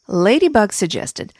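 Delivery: plain reading voice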